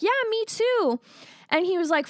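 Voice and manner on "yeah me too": chirpy. Retold or imitated. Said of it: "And I'm like".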